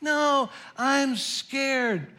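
high, drawn-out little cub voice; scared Little Bear. A narrator's reading. Little Bear tells Papa he can't sleep, scared cub voice. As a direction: play a frightened bear cub, slowly. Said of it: "Little Bear would say".

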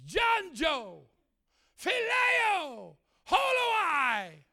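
high, drawn-out calls with falling ends; calling out names. Retold or imitated. Preacher calls out names God writes, high proclamation. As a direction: proclaim each name separately.

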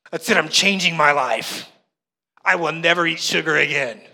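loud, emphatic resolution played for laughs; mock bold vow. Preacher acting out a resolution-maker, mock determined vow. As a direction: declare it like a dramatic pledge. Acts out a New Year's resolution.

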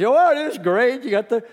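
high, loud gushing voice; mock gushing crowd. Preacher mocking a crowd swooning over a story, high and gushing. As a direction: ham up a swooning audience member. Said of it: "if I had been Jesus".

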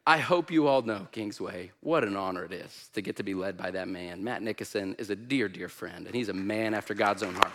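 low, soft and heartfelt; sincere tribute. Real, not acted. Guest preacher honors the church's leader as a dear friend, softly sincere.

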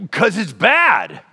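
put-on slow, whiny child insisting; stubborn child. Pouting child stubbornly answering God, slow and whiny. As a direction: drag it out like a pouting kid.

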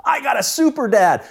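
put-on high, delighted boyish cry; a ten-year-old in awe of his dad; thrilled kid. Boy watches dad chase rock throwers, high excited cry. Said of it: "I'm like".

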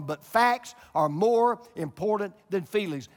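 a preacher hammering the point; full-force preaching. Acted. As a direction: drive every phrase home to the congregation.

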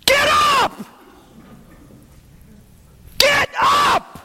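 very high, very loud shout; booming command. Acted. Paul calling sluggish believers to rise, booming shout. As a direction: roar it to wake the sleepy crowd. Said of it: "Paul is saying to you".

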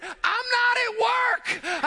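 high, slow, loud yelled refusal; frantic shout. Retold or imitated. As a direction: shout it over the rapids. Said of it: "I said".

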